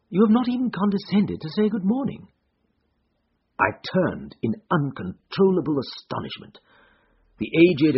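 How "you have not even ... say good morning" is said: high-pitched mild reproach; lightly voiced Holmes. A narrator's reading. Disguised Holmes chides Watson for no greeting, high and lightly reproachful. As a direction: gently chide in a raised voice.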